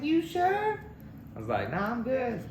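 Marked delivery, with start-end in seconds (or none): 0.0-0.8 high mock lady voice. Retelling Rosie insisting. 1.7-2.4 high mock polite voice. Retelling own refusal.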